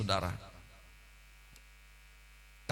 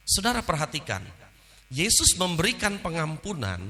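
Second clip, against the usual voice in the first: ordinary talk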